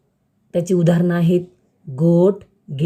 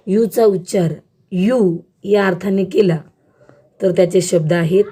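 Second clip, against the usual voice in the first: ordinary talk, nothing marked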